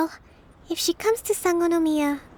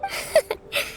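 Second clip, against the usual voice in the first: light, high giggle; amused giggle. Acted. Leader amused by a companion asking about food, light giggle. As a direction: giggle briefly at the question.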